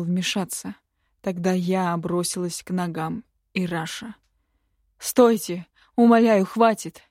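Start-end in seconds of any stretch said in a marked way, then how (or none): 5.0-7.0 loud, urgent begging; raised, pleading voice; desperate plea. A narrator's reading. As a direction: cry out to stop it. Daughter begs the ruler to stop.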